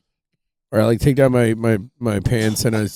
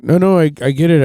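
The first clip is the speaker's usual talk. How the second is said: quick, raised, flustered denial; hurried protest; 6 semitones higher, 5 dB louder, faster than usual. Retold or imitated. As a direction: rush to reassure the ranger. Himself answering the ranger.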